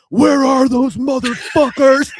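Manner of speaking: hammed-up enraged yelling; retold angry yell. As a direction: bellow it across the restaurant, slowly. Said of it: "Jay starts yelling".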